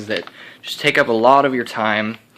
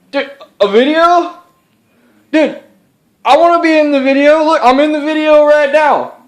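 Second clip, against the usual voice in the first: much higher, louder, eager buddy voice; a much higher goofy sidekick voice begging to be in the video; excited alter ego; 6 dB louder than usual. Acted. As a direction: barge in as the pushy alter ego. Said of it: "alter ego that never comes to say hi".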